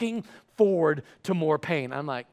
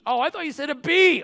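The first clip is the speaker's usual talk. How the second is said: high, put-upon clerk voice; mock a clerk at popping point; exasperated store worker; 8 semitones higher than usual. Overworked lady realizing she misheard the letter, exasperated. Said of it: "at a point of popping".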